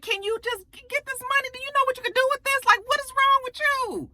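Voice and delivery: high, fast, incredulous nagging; high-pitched disbelief, rattled off fast; exasperated pleading. Retold or imitated. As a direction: beg him to just take the money. Pleading quote at Nate.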